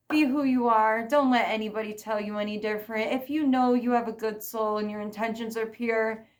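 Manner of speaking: ordinary delivery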